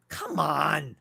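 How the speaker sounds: ordinary talk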